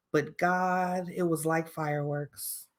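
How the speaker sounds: soft, slow, dreamy whisper of a line; dreamy acted line. Shown as a demonstration. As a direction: sigh it out like a lovestruck confession. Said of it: "I'm gonna put my acting voice on".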